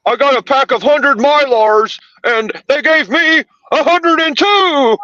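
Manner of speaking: mock whiny complainer. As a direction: parody a petty complainer. Mocking Journey's complaining.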